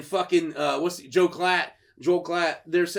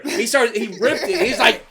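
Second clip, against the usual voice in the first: ordinary talk, nothing marked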